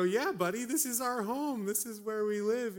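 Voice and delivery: put-on high, loud, laughing reassurance to a little boy; excited dad. Dad to son in the driveway, high and laughing. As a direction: cheerfully insist it's home.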